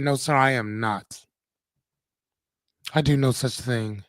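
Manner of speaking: put-on low-pitched, lightly Russian-accented denial; a hint of a Russian mother's accent; firm denial in a light Russian accent; Anya's mom's Russian voice. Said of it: "in my Anya's mom Russian voice".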